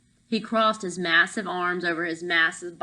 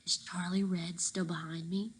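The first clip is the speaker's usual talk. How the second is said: softer, hushed question; whisper; 8 dB quieter than usual. Acted. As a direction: keep it secret from the guard. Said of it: "Tank lowered his voice to a whisper".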